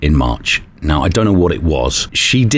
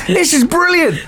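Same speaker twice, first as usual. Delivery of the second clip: laughing. Real, not acted. Laughing over new microphone.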